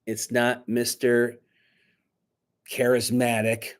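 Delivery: plain reading voice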